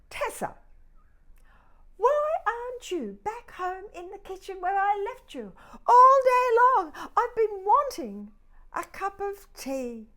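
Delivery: high loud fond scolding; owner's voice. Acted. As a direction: scold the lost teapot fondly.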